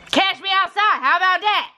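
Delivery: high, hammed-up catchphrase voice; catchphrase impression. Host quoting a meme at the family, high impression.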